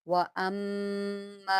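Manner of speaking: व अम्मा slowly, nasal hum held on the mm; with ghunna. Shown as a demonstration. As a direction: hum through the doubled m in व अम्मा. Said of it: "तो फ़ौरन गुन्ना करें".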